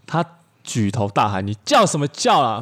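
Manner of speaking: usual speaking voice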